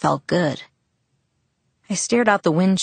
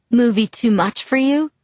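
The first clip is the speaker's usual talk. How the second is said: teasing, heartless jab, pitched higher; 6 semitones higher, 3 dB louder than usual. Acted. Jacob to sick Mike, heartless teasing. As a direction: needle him without any sympathy. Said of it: "Jacob asked heartlessly".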